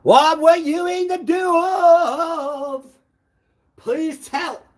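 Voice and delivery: drawn-out high interrogation; slow high questioning. Real, not acted.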